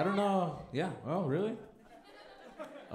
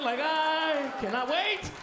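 high, drawn-out "cannot wait"; hammed-up excitement; 9 semitones higher, 3 dB louder, much slower than usual. Comic who cannot wait for construction awards, drawn-out excitement. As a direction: stretch every word out, high and eager. Hammed-up repeat of his line.

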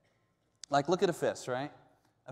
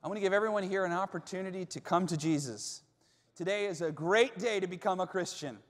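higher, gentle invitation to pray; altar call; 4 semitones higher than usual. Acted. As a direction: invite the listeners to come forward.

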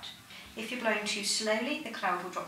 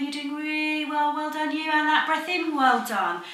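louder, higher cheering praise; 6 semitones higher, 11 dB louder than usual. Real, not acted. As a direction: reward the effort warmly. Praising patient loudly after blow.

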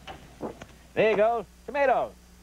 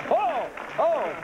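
hammed-up Italian accent, high; a big Italian ooh, mamma mia; mock Italian; 10 semitones higher than usual. Mock Italian over polyester.

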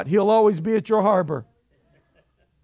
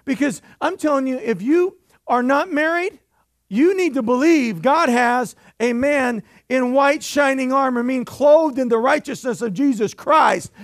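rising, louder preacher's build; fiery preaching; 5 semitones higher, 4 dB louder than usual. Acted. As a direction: preach it hard to the congregation. Preaching at full force.

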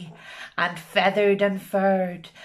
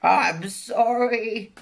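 slow, higher, frightened dragon; spluttering; 4 semitones higher, 3 dB louder, slower than usual. Acted. Dragon apologising to the beast, mild frightened splutter. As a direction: stammer it out scared. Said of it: "he spluttered".

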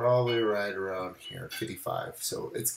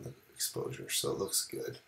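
voice giving out; 11 dB quieter than usual. Real, not acted. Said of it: "I've kinda lost my voice".

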